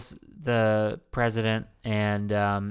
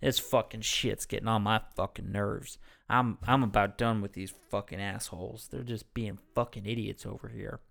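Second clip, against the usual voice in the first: weary, grumbling New Orleans coworker; New Orleans coworker impression; Jimmy; 5 dB quieter than usual. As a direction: trail down softer, fed up with everyone. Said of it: "And he's like".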